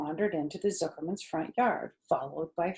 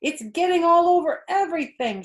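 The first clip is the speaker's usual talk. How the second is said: put-on higher, louder alarmed girl; shouted; 11 semitones higher, 7 dB louder than usual. A narrator's reading. Fern shouting about pie everywhere, higher and louder. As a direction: shout the mess out. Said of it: "shouted Fern".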